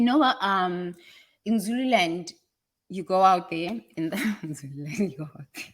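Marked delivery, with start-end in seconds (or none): 4.0-5.6 low, quiet, words lost in laughter; real giggle swallowing the words; laughing. Real, not acted. Speaker telling about Zululand farms, breaking up laughing.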